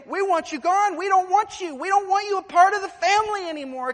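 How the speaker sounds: high, loud shouted rejection; preacher voicing a family shouting him out; family's angry rejection. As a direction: hurl it at the outcast. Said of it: "And they say".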